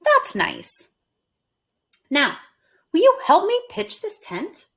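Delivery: high bright request; Amelia Bedelia voiced. Amelia Bedelia asking the boys for help, high. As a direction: ask brightly. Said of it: "said Amelia Bedelia".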